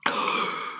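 sharp intake of breath in alarm; frightened gasp. Acted. Hook hears the ticking crocodile, frightened gasp. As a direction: suck in air in sudden fright. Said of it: "gasped Hook".

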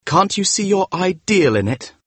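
high, bitter challenge. A narrator's reading. Dorian challenges the painter over his ideal, bitterly. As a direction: throw the question at him bitterly. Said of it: "asked Dorian bitterly".

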